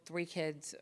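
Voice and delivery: usual speaking voice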